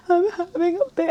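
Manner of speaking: high, squeaky mock-tearful voice; high-pitched blubbering reenactment; imitated crying. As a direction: barely get it out through pretend tears. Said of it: "like, crying, snot, nosy".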